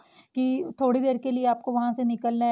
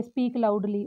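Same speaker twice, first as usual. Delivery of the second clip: usual speaking voice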